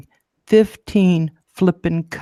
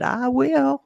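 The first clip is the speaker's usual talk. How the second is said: chirpy promise; 9 semitones higher than usual. Retold or imitated. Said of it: "I said".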